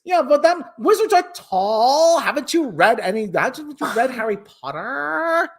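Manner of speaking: put-on Valley Girl voice rising at phrase ends; Valley Girl upward inflection. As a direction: swoop up into questions like a Valley Girl. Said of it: "everything's an upward inflection with her".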